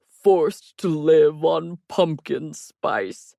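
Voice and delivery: very slow, loud mock-tragic lament; overacted martyr in a put-on voice; drama king. A narrator's reading. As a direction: drag out the suffering theatrically. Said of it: "Stop being a drama king".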